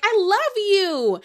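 put-on high, loud, drawn-out drunken gushing; drunk Summer. Drunk castmate apologizing in the pool, slurred-style mock gushing. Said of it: "that's how you know when Summer is drunk".

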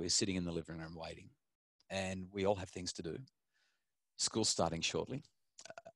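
soft, measured parental ultimatum; calm firmness. Retold or imitated. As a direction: quietly reason with a stubborn child, leaving pauses. Father retells calm ultimatum to daughter.

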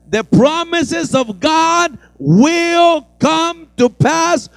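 loud, high, slowing preaching climax; preaching at full force. Acted. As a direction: build the sermon to a loud climax.

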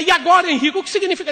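angry shout. Retold or imitated. Wife to husband, angry shouted demand. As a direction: demand an answer at full voice.

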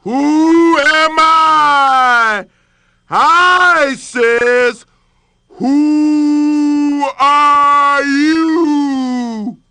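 very slow, ringing echo from below; the well echoing the lion's roar back. Acted. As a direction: stretch it out like a far echo. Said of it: "the voice came back".

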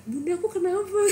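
crying to mom. Retold or imitated. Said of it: "gue langsung nangis kayak".

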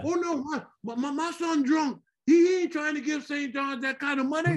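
put-on dad telling the crowd his son is drunk, high-pitched; worried Southern dad. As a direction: protest like a frantic father. Father voiced in the story.

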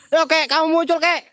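loud, high call; shouted summons. Real, not acted. Host orders the old ghost to appear, shouting. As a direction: shout to draw someone out.

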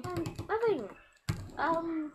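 high voice cracking with nerves. Pretend. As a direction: let the voice crack and wobble. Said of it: "You sound different".

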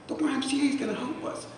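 high, rising echo in disbelief; a broke dad echoing his son, astonished and high; stunned disbelief. Retold or imitated. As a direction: repeat it like you cannot believe it.

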